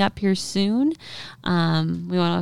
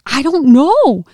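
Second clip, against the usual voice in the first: panicked reply; 9 semitones higher, 10 dB louder, slower than usual. Retold or imitated. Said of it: "He's like".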